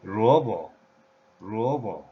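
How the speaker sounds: "ruobo" with short, falling "bo"; short final syllable. Shown as a demonstration. Teacher giving the radish example, short falling "bo" in "ruobo". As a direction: let "bo" in "ruobo" drop off briefly after the first syllable.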